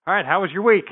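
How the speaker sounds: calling out to the room; a loud, high call to get the room's attention; loud greeting. Real, not acted. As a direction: raise the voice to start class. Calling class to order.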